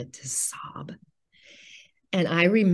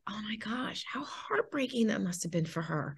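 soft, breathy whisper of sudden sympathy; hushed inner thought; 4 semitones higher, 6 dB quieter than usual. Retold or imitated. Mother silently realizing the nurse's pain, soft whisper. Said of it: "And I just thought".

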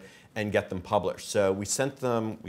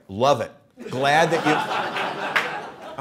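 laughing quote; 5 semitones higher, 8 dB louder, much slower than usual. Retold or imitated. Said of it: "he's like".

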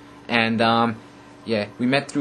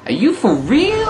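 put-on much higher, slow swooning girl voice; mock lady fan; 4 dB louder, slower than usual. Imagined female viewer answering the vlogger, mock swooning girl. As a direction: squeal it like a starstruck girl. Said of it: "and you go".

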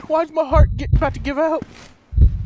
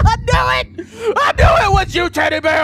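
high hammed-up accusing shout; mock-dramatic shout; 8 semitones higher than usual. Real, not acted. Player accusing a creepy teddy bear, hammed-up shout. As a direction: cry out at the bear, half laughing.

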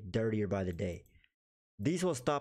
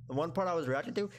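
ordinary delivery

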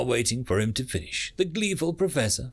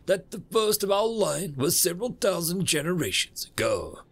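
lightly voiced universe, higher than narration; explaining universe; 7 semitones higher than usual. Universe telling humans a tale, lightly lecturing.

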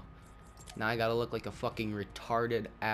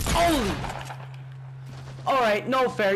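startled shout; 10 semitones higher, 5 dB louder than usual. Real, not acted. Sudden surprise in game.

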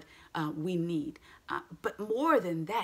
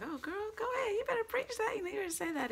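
much higher, cheering friend voice; imitating Tess; 4 dB quieter, faster than usual. Tess cheering the speaker on, high and playful. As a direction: hype her up like a girlfriend. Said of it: "Tess was like".

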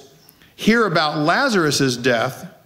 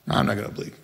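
whispered stubborn Thomas; 9 semitones lower, 7 dB quieter, much faster than usual. Acted. Thomas voiced refusing disciples' report.

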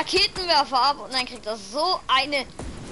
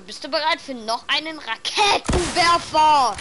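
taunting; 5 semitones higher, 6 dB louder than usual. Real, not acted. Taunting an enemy with rockets.

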